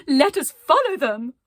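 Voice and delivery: high, loud rallying cry; excited call. Acted. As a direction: cheer the group onward. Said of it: "in excitement and said".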